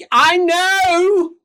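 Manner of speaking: high, loud grumpy bargeman; Lucas voice. Acted. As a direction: whine it out as a put-upon captain. Lucas answers.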